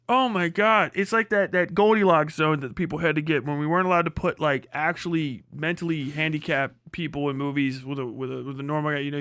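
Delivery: ordinary talk, nothing marked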